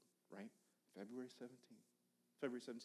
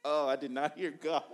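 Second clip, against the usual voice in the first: laughing; 4 semitones higher, 14 dB louder than usual. Real, not acted. Laughing at his own embarrassment.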